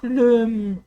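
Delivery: normal talking voice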